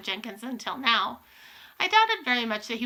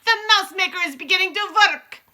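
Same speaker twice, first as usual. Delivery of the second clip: high, loud witch proclamation; exultant, ringing shout; triumphant shriek; 8 semitones higher, 8 dB louder than usual. A narrator's reading. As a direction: cry out as the scheme succeeds. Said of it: "shrieked the Grand High Witch".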